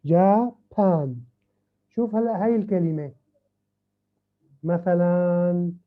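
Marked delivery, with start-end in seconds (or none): 0.0-1.2 "جابان" loud and slow, split after جا; two separate syllables. Shown as a demonstration. Teacher proving it has two syllables, loudly split. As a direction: punch out both halves of "جابان". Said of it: "It has two syllables".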